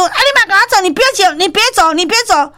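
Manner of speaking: high, pleading shouts to stop; retold furious don't-go calls; imitating angry chase. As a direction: chase him down demanding he stay. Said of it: "就是很生气".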